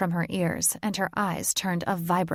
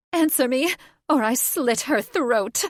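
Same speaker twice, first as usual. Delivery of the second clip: higher, louder frantic threat; shrill threat; 6 semitones higher, 5 dB louder than usual. Acted. Catherine threatens the girl's life, shrill and loud. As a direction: scream the ultimatum.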